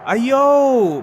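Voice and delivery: put-on loud, high "Ayo" in a Bangladeshi accent; loud call. Heel hollering at his old rival in a Bangladeshi accent.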